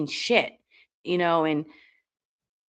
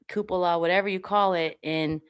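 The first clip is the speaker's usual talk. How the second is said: ordinary delivery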